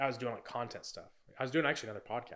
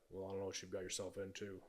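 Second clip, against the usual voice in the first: lower, softer stern dad voice; imitating his dad; 4 semitones lower, 10 dB quieter than usual. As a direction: mimic the unbothered father, low and soft. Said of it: "he was just like".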